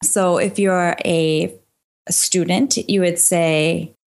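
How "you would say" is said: ordinary talk, nothing marked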